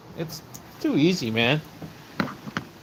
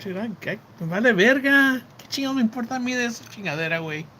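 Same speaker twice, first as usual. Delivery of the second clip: retold angry retort; 9 semitones higher, faster than usual. Answering a Russian troll, heated fast Spanish retort. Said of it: "I'm like".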